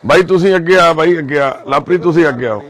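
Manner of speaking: loud, brisk summons; calling out. Real, not acted. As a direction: call them forward loudly. Calling people to come forward.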